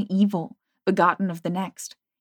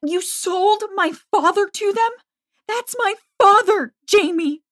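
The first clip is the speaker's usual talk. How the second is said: screaming; 14 semitones higher, 6 dB louder than usual. A narrator's reading. Continues her near scream.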